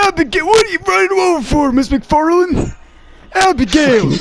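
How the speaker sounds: high strained mock-pained shouting; John Marston impression. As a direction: call out as if constantly in pain. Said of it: "It sounds like he's in pain constantly".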